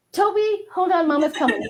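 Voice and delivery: calling to pet. Real, not acted.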